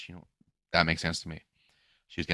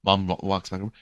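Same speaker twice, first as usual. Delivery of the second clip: ordinary talk, nothing marked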